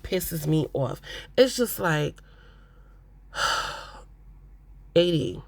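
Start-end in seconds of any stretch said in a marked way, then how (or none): 3.3-3.8 long, heavy exhale; sigh, fed up with her; exasperated sigh. Real, not acted.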